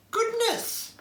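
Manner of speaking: put-on high, startled old lady's exclamation; old lady's surprise. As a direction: gasp it out like a kindly grandma. Old lady exclaims on seeing bag.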